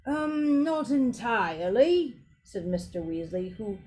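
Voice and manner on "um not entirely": drawn-out, higher hedging; slow, uneasy character reply; hesitant character. Acted. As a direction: hedge slowly, reluctant to answer. Mister Weasley hesitates over his answer.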